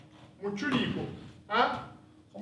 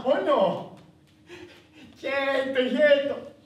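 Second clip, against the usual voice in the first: high, loud, eager exclamations; excited child; 7 semitones higher, 5 dB louder, slower than usual. Acted. Boy realising his mother is in paradise, excited. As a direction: burst out with joy and relief.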